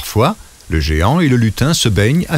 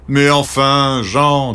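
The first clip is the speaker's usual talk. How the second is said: friends voice; 4 semitones higher, much slower than usual. A narrator's reading. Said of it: "disent ses amis".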